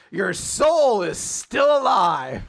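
high, slow drawn-out mock scream. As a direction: stretch it out like a hero's dying cry. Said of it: "he just screams".

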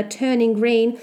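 plain reading voice

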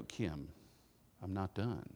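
low, soft warning from the wife; a quiet, pointed stop; mock stern wife. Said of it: "she would look at me and go".

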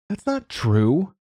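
put-on high, slow firm girl's denial; a girl standing her ground; insistent child. A narrator's reading. Girl contradicting the dog owner, high and slow. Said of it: "said Alma".

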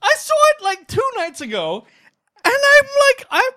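very high, loud outburst; excited shout. Real, not acted.

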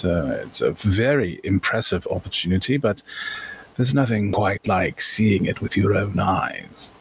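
put-on cultured auction-goer with a college accent; courteous gentleman merchant. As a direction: introduce yourself with polite old-world formality. Player speaks as Master Locke Calder.

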